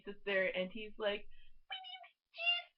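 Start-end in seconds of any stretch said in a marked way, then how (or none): 1.7-2.7 squeaky-high mock Mexican accent; Channing Tatum's Mexican voice. Fan quoting the movie's inside joke, mock Mexican accent. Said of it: "Your Mexican voice totally sucks".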